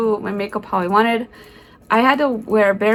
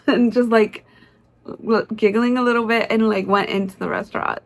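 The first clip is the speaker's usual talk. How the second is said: normal talking voice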